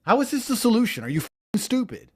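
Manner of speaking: normal talking voice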